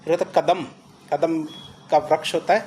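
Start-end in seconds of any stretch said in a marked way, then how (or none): none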